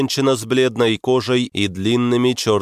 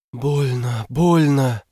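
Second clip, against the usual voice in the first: pained creature; 4 semitones higher than usual. Acted. Fox head in belly cries.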